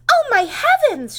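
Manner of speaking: high, loud, slow gasp of alarm; shocked cry. Acted. Mother seeing stripes, loud high shocked cry. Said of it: "she cried".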